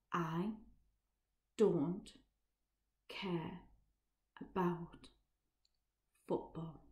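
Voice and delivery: each word alone, long pauses; slow and low. Acted. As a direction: spell it out word by word, quietly. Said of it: "I'm going to say this slowly".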